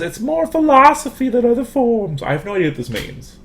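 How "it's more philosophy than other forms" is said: much higher, mock-prissy quote of a colleague; mocking Morgan voice. Lecturer mocks a colleague's slide note, high mock voice. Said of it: "She says".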